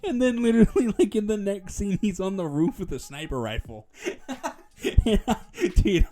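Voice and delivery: high-pitched, giggling through the retelling; real amusement breaking into laughter; laughing. Real, not acted. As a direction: crack up while telling the story. Cracking up retelling a funny scene.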